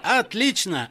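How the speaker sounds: high-pitched pleased exclamation; delighted. A narrator's reading. John hears he'll be rich, high and delighted. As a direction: jump on it, delighted. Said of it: "обрадовался Джон".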